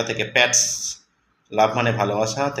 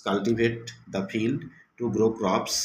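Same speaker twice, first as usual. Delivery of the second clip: slow and softer English sentence; slow reading aloud; 4 dB quieter, much slower than usual. Shown as a demonstration. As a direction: read it gently and slowly to the class. Teacher reading example. (ধীরে নরম করে পড়া)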